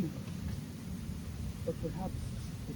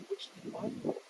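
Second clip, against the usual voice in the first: hushed whisper; 3 semitones lower, 14 dB quieter, faster than usual. Acted. Confiding the author's oversight.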